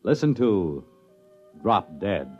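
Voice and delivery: ordinary delivery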